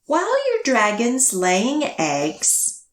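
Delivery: lively picture-book storytelling; reading to children. A narrator's reading. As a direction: read it to a room of little kids, bouncing the rhyme. Reads new picture book aloud.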